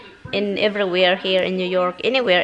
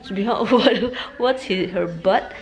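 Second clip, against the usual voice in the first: laughing; slower than usual. Real, not acted. Laughing at dog.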